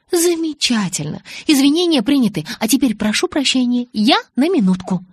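high, loud, mock-gracious dismissal; brisk sarcasm. A narrator's reading. Bella curtly accepts Jacob's apology to leave, sarcastic. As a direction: accept it pointedly and get away.

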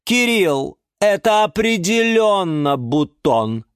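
high, slow, emphatic pronouncement; ceremonious, stretched announcement; solemn verdict. Acted. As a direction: deliver the diagnosis with drawn-out weight.

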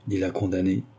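usual speaking voice

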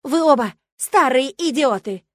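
high, loud, drawn-out yell; shouted insult. A narrator's reading. Milena turns for the exit, shouting at both. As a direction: yell the insult as you storm off. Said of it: "выкрикнула она".

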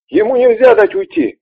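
high, loud urgent cry; urgent shout. Acted. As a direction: yell it as you chase. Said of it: "сказал он и рванул за ним".

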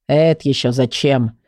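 low-pitched grumpy character voice; grumbler. A narrator's reading. Grumbler asks what the plan is for, grumpy. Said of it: "уточнил Ворчун".

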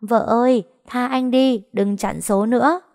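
high-pitched teasing plea called out; mock husband pleading aloud; speaking up loudly. A narrator's reading. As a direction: call across the room, playfully begging. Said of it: "ngẩng lên nói to".

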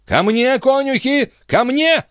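very high, frantic call for help; yelling. A narrator's reading. Stationmaster summons his grooms, yelling. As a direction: yell high to summon the men. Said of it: "завопил смотритель".

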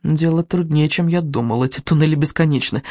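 plain reading voice

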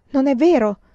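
a loud, high cry of protest; shouted denial. A narrator's reading. A young woman protesting to her ally, loud cry. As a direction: fling the denial out. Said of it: "gridò Luna di Primavera".

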